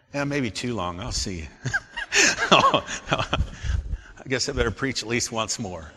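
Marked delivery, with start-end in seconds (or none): 1.5-4.1 high chuckling laugh. Real, not acted. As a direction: laugh at your own remark. Chuckles at own remark.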